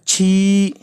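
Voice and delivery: slow, drawn-out छी; slowly spelled chhee; slow demo. Shown as a demonstration. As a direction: stretch out छी for learners. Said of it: "लंबा खींचेंगे".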